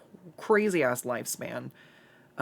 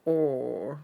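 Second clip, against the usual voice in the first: normal talking voice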